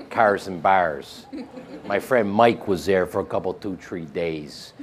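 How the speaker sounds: thick, slow Chicago accent, dropping low; hardcore Chicago accent. Shown as a demonstration. Actor showing what Chicago sounds like, hardcore Chicago accent. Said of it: "a Chicago accent is very hardcore".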